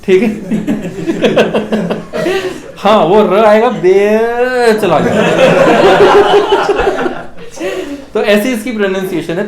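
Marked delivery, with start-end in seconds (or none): 0.3-2.7 chuckle. Real, not acted. Laughs at his pun. 5.4-8.1 loud high laugh at own pun. Real, not acted. Teacher laughing at his own pun on bear, loud laugh.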